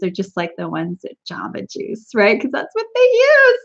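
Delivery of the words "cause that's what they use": laughing. Real, not acted. Laughing squeal at own joke.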